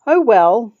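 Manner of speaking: a loud resigned sigh on the words. Acted. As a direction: breathe it out, resigned. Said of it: "I sighed".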